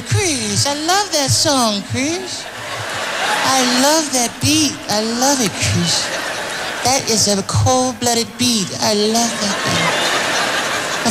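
high, slow, breathy-free [name] impression; high, slow [name] imitation. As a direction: coo it slowly like Michael. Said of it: "He's like".

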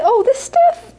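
very high-pitched, dismissive mock reply; imitated mother. Mother answers questions about daughter's Buddhism, high imitated dismissal. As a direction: play the mother, voice leaping up high. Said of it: "And she'd say".

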